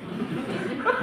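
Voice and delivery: airy giggle rising high; high breathy laugh. Real, not acted. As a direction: let a soft giggle slip out. Host laughs at own joke. (mahinang tawang mataas)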